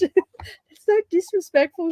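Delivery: high, drawn-out mock Scottish accent. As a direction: imitate her complaint in a Scottish accent. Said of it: "in a Scottish accent".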